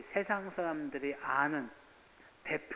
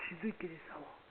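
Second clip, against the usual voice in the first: quiet aside; 8 dB quieter than usual. Acted. Muttered aside.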